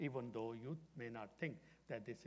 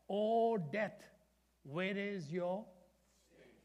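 higher, louder, slow rising call; proclaiming the verse; 7 semitones higher, 8 dB louder, much slower than usual. Shown as a demonstration. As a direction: cry it out as a challenge to death. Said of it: "One more time".

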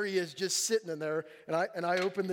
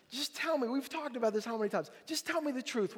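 coaxing father; 6 semitones higher than usual. Retold or imitated. Father coaxes upset son.

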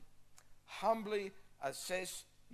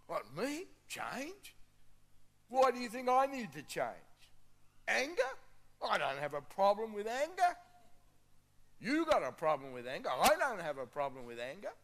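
loud, high-pitched mock outrage; indignant denial; 6 semitones higher, 8 dB louder than usual. Proud person refusing to admit a need to change, indignant. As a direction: protest as if wildly offended by the very idea.

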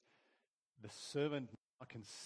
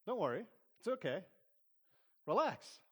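reassuring; 7 semitones higher, 5 dB louder than usual. Acted. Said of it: "Elisha says".

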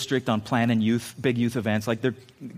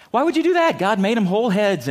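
put-on high, loud incredulous grocery kid; indignant clerk; 7 semitones higher, 4 dB louder than usual. Grocery kid protests to the lady, high and incredulous. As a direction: squeal it like an outraged teen clerk. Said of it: "And he goes".

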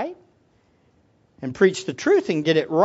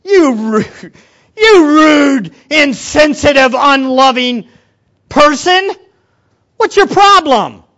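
loud, higher indignant outburst; mock angry rant; 5 semitones higher, 10 dB louder, slower than usual. Ignored at church, shouting at the rude person. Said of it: "ignored at church, angry rant".

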